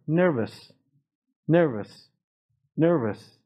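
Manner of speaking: "Nervous" with a clear ER straight after the N; teacher's careful NER-vus for "Nervous". Shown as a demonstration. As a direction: sound the R in "Nervous".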